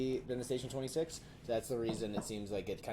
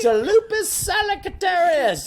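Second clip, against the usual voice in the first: much higher, louder, slow grand narration; dramatic reading voice; 17 dB louder, slower than usual. Shown as a demonstration. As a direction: read it like a pompous documentary. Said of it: "I'll read it like this".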